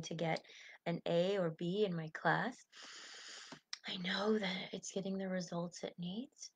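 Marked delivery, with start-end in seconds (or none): 3.9-6.5 whispered reassurance to yourself. Shown as a demonstration. Coach whispering calming self-talk to listeners. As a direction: breathe the words out unvoiced. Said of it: "demo of calming self-talk".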